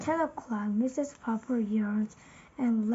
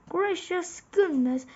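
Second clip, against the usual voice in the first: higher, excited exclamation; exclaiming; 6 semitones higher than usual. A narrator's reading. Mrs. Popper hearing the radio greeting, excited exclamation. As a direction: blurt it out in surprise. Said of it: "exclaimed the Popper".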